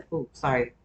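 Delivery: ordinary talk, nothing marked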